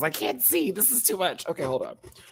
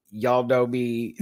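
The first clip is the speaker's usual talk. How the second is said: usual speaking voice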